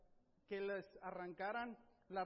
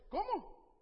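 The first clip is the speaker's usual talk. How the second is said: indignación sorprendida; 12 semitones higher, 10 dB louder than usual. Acted. Pablo indignado ante los guardias.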